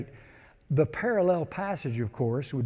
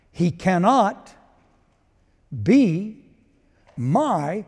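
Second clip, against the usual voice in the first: cannot, be, my, each spaced and louder; slow emphatic; 6 dB louder, much slower than usual. Acted. Preacher driving verse home to church, slow and emphatic. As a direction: space the words out for weight.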